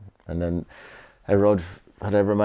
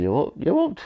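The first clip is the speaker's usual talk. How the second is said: high-pitched West Country impression of the agent; bouncy, raised West Country accent, getting started; 11 semitones higher than usual. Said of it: "as [name] says in the West Country accent".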